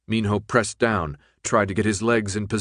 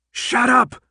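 high, loud angry shout; furious retort yelled; shouted back; 14 semitones higher, 5 dB louder than usual. Acted. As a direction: shout him down. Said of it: "Minho shouted back".

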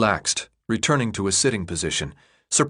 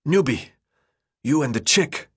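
short winded phrases, higher; huffed; 4 semitones higher than usual. A narrator's reading. Exhausted runner places newcomer Thomas, huffing. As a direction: huff it out in broken bits. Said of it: "he huffed".

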